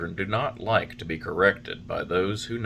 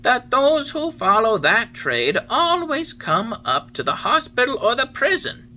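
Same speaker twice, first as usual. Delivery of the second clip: calm cricket voice; 6 dB louder than usual. A narrator's reading. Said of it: "in a calm voice".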